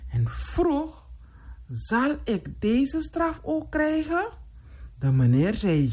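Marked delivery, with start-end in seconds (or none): 1.7-4.3 high-pitched, mildly frightened asking; fearful question. Acted. Scared King asks the man about punishment, mildly fearful. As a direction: ask it high, with a touch of fear. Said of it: "King was bang en vroeg".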